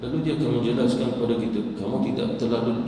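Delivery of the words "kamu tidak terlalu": normal talking voice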